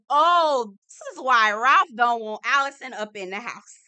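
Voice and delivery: loud, triumphant exclaim; loud realization. Retold or imitated. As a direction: call it out loud. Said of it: "This is where I said".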